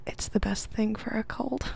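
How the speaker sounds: acted stage whisper. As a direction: whisper it ASMR-style. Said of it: "this is all in whisper".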